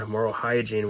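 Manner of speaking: ordinary delivery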